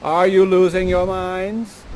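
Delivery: loud, slow, drawn-out question; theatrical questioning. Acted. Storyteller taunts the lost men, loud slow question. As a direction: ask it grandly, stretching each word.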